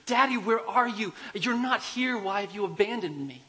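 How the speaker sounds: put-on high, loud frightened little girl calling for her dad; loud, high plea from a girl alone at night; scared child crying out. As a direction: cry out into the dark like a terrified child. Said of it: "she starts screaming".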